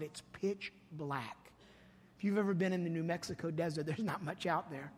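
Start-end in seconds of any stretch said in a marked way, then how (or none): 0.0-1.5 hushed and slow. Retold or imitated. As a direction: draw it out in a hush, setting a dark scene. Retelling scary desert night.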